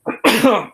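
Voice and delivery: last strained cough; clearing the throat of water. Real, not acted. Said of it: "water went down the wrong hole".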